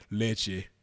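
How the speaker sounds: imitating mocking laughter. Bystanders in the background laughing at him, imitated mockery. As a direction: imitate onlookers sniggering at him. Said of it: "Motherfuckers is laughing at me".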